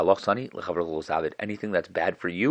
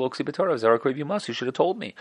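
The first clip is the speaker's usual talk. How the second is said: indignant complaint; 9 semitones higher than usual. Retold or imitated. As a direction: complain he should have known. Convert complains to Shammai.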